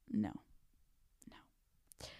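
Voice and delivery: a breathy whispered no. Real, not acted. Whispered denial to listeners about summer plans.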